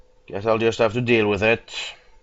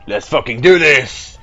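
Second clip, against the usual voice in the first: loud, raised battle-ready yell; pumped up; 4 semitones higher, 9 dB louder than usual. Real, not acted. Player heading into town, pumped-up shout. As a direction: shout it to charge in. Hyping himself before the fight.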